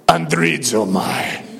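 low, slow, drawn-out pronouncement; deep bassy voice. Shown as a demonstration. Preacher unveiling the Greek word, deep and bassy. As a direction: intone it slowly, down in the chest. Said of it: "say it with a deep, bassy voice".

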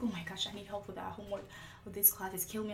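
hint of a stressed classmate begging; mock desperate classmate. Classmate asking her for help, quietly despairing. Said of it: "she was like".

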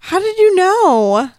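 high, loud, amazed question; surprised. Real, not acted. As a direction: ask in disbelief. Surprised at guess.

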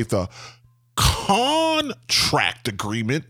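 "contract agreement" with CON drawn out long; slow, over-the-top stress on "contract agreement"; over-the-top emphasis. Shown as a demonstration. Owner on the job site, over-the-top stress on "contract agreement". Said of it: "I kinda put emphasis on the word contract agreement".

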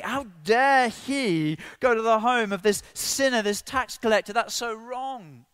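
high, loud mock outrage; indignant grumbling. Religious people objecting to Jesus visiting a sinner, indignant. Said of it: "Immediately they're grumbling".

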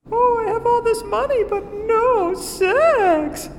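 badly faked high voice; unconvincing fake voice. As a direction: lure him in a silly falsetto.

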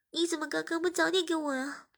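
put-on a touch of pouting young woman. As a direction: complain sweetly. Voices 诗雅. (撒娇抱怨)

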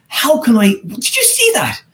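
high, excited squeal of delight; thrilled replay of the moment; elated. Retold or imitated. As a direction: gush like you can't believe it. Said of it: "I was elated".

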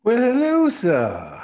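hello sung out high and loud; mock-grand hello to a friend; playful greeting. Greeting co-host Robbie with a playful high hello.

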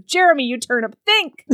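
playfully scold, rising to a high finish; mock exasperated scolding. Heroine calling Jeremy a turnip, mock exasperated.

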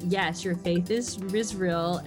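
normal talking voice

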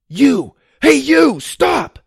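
very loud, high yell; shout. A narrator's reading. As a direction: yell after the fleeing man. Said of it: "he shouted".

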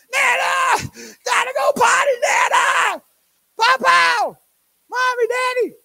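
put-on high, loud little-girl shouts; toddler calling out. Little girl calls for her family from the bathroom, high shouts. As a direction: shout it like a three-year-old who needs the potty now.

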